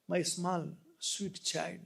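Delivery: soft, slow, loving; tender. Acted. King softly speaking of his lost son, tender. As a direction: say it gently like a father. Tender gloss after loud verse.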